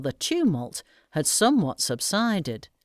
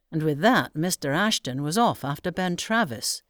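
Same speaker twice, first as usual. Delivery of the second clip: plain reading voice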